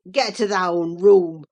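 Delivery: low, loud, furious order; inarticulate with passion. A narrator's reading. Cousin bursting in to throw them out, inarticulate with passion. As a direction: order them out in a deep, choked rage. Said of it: "in a voice almost inarticulate with passion".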